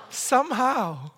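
amazed answer. Shown as a demonstration. Preacher leads congregation in the phrase, slowly amazed. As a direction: stretch it out in wonder. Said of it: "say it exactly the way I say it".

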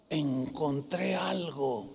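slow, low and soft retold line; understated announcement. Lawyer reports back to his pastor, imitated quiet, slow reveal. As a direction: let it out slowly, low and quiet. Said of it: "Nada más así me dijo".

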